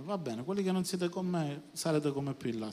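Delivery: normal talking voice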